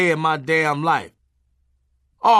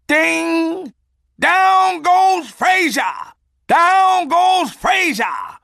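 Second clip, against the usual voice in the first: hammed-up ringside call, much higher, louder and slow; boxing announcer; 8 dB louder, much slower than usual. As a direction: bellow it like a ringside announcer calling a knockout. Boxing announcer call, knockout punchline.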